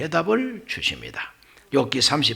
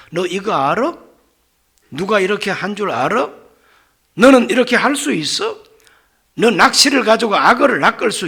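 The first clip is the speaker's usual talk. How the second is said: insistent challenges, building higher and louder; acted interrogation; 5 semitones higher, 6 dB louder than usual. God challenges Job's strength, in mounting interrogation. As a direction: fire off rhetorical questions with mounting force. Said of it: "계속해서 그렇게 말씀하십니다".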